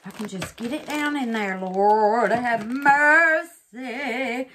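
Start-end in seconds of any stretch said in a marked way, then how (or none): 1.6-4.5 loud, slow drawn-out Lord have mercy; relieved exclamation. Real, not acted. Crafter to viewers as the resin sinks, loud drawn-out relief.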